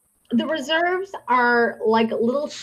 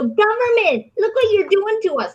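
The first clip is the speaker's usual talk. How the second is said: high mock wail, a bit louder; mock whine; 10 semitones higher, 3 dB louder than usual. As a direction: whine like a complaining tribe member. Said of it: "they sit there and go".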